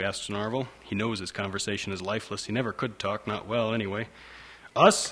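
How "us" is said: a loud, raised "Us?", higher; father booming "Us?" into the phone. Acted. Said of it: "his father says loudly".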